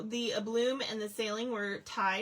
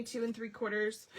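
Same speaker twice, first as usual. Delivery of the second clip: talking through hiccups; hiccuping mid-sentence; 3 dB quieter than usual. Real, not acted. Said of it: "I've got hiccups".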